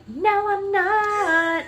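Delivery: put-on high, drawn-out childish protest; mock whiny child denial; imitating defiant child. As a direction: stretch it out high like a stubborn kid. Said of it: "she's saying back to me".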